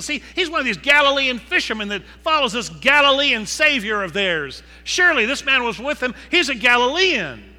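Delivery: mock the Galilean with contempt; mocking accuser. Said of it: "their accent was kind of mocked".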